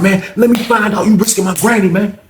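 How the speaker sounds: high, loud threat; threatening. Retold or imitated. Grandson warns Terrence he'll fight him over granny. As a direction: warn him you'll fight.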